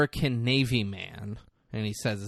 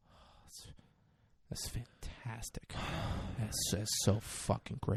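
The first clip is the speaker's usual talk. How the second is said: hushed awe; 2 semitones lower, 13 dB quieter than usual. Retold or imitated. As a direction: breathe it out in secret glee. Masrani's hushed delight.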